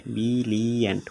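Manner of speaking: "Brilliant" stretched into three separate beats; syllable by syllable. Shown as a demonstration. Teacher asking how many syllables, "brilliant" sounded out in three beats. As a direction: break "brilliant" into bi-li-ant. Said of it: "তিন syllable".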